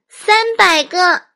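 put-on high, slow, drawn-out child voice. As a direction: say it big and slow. Child asks for balloons.

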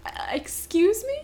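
mock bafflement. Retold reaction to the film.

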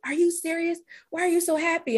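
lightly voiced baffled client; puzzled clients. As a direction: imitate clients who can't understand. Clients puzzled by her joy.

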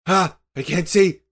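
panicked cry. Acted. Darkness spell blinds the character.

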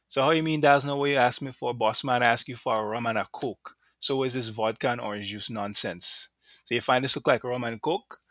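put-on amateur Grenadian accent, mildly indignant. Customer argues over getting vodka instead of rum, Grenadian accent. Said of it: "That's my terrible Grenadian accent".

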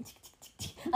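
soft whispered tic tic, like little paws tapping; breathy ticking of tiny paws walking; whispered paw steps. Retold or imitated. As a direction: tick out the dog's steps in a whisper. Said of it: "fazendo ela andar assim".

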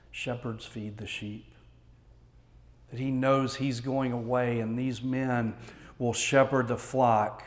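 ordinary talk

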